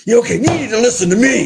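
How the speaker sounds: sudden high, booming preacher yell; fast, forceful shout that makes listeners jump; Pastor Mark. Acted. Co-host mimics the screaming pastor for the host, startling loud shout. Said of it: "that's how he talks".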